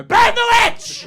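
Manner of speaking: high, loud, slow witch-hunt cry; mock shout. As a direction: bellow it like a medieval mob. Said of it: "stare at them in the eye and go".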